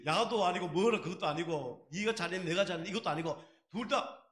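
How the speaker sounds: ordinary talk, nothing marked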